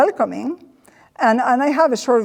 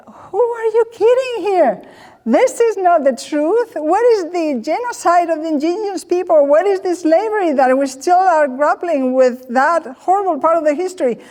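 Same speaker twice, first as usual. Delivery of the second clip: higher, indignant retort to the video; mock indignation; 7 semitones higher than usual. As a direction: scoff at the film's fake history. Inner protest at the welcome video.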